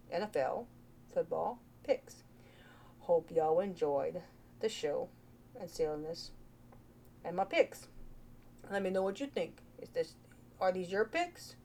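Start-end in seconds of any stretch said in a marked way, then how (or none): 0.1-9.0 soft unvoiced whisper. Real, not acted. Vlogger whispering her sign-off to viewers. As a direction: breathe it out in a whisper.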